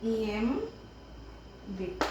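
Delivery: very slow and soft, syllables drawn apart; writing-out pace. Real, not acted. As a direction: draw it out as you write. Writes the word larger, slowly. (लिखते हुए धीरे-धीरे)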